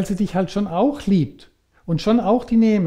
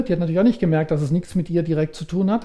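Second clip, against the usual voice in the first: ordinary talk, nothing marked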